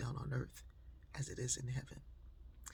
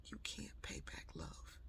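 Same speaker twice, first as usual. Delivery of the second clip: tender whisper; 10 semitones lower, 14 dB quieter than usual. Retold or imitated. Comforting the crying young man.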